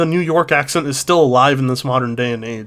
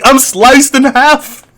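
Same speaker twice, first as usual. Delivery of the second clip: high, loud, drawn-out cry; hammed-up wail of a wronged victim; mock outrage; 9 semitones higher, 5 dB louder, much slower than usual. Acting out a plane crash victim, in mock outrage.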